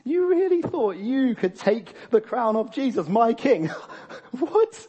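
high, loud incredulous mockery breaking into a laugh; hammed-up laughing disbelief; laughing scorn. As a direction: act God scoffing at rebels, ending on a squeaked "What?". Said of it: "he's kind of, like, laughing, saying".

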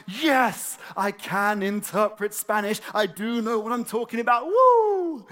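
high, loud proud whoop; triumphant cheer. Retold or imitated. Learner celebrating Spanish progress, high and loud. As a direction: crow in triumph. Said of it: "it's like".

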